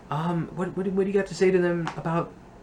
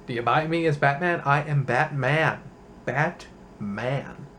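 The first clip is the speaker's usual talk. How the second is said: a lightly voiced, softer Batman; a flat, deadpan Batman pose; Clooney Batman; 2 semitones lower, 4 dB quieter, slower than usual.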